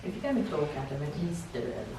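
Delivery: hushed. Acted. Teasing a player to speak up.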